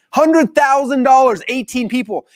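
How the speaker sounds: high, loud, drawn-out amazement on the figures; astonished. Real, not acted. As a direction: marvel at an unbelievable number. Marvels at the huge sale.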